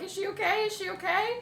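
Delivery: high, anxious repeated question; mock-tearful high pleading; retold tearful panic. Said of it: "I'm freaking out. I'm, like, crying".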